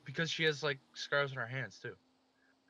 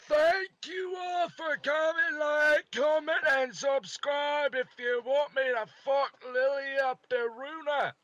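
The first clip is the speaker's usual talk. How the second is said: hyped shout; 10 semitones higher, 4 dB louder than usual. Real, not acted. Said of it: "outro shouted".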